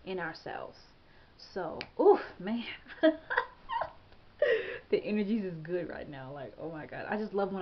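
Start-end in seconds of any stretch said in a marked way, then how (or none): 2.7-3.9 real high giggle; high-pitched laugh. Real, not acted. Laughing at the good energy.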